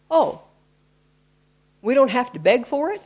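played-up wondering question; mock surprise. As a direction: act pleasantly taken aback, rising at the end. Acts out the listener's surprised realisation.

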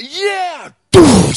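high yelled crash noise; mock crash yell at the wheelbarrow; crash sound effect. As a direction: shout the impact like a cartoon. Running into wheelbarrow.

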